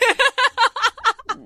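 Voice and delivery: high laugh bursting out mid-quote. Real, not acted. Podcaster breaks into high laughter while quoting the angel. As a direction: crack up while voicing the angel.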